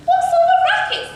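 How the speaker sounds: very high, loud question; Cindy's high, loud complaint; crying out. A narrator's reading. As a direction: call it out shrilly in annoyance. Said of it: "Cindy cried".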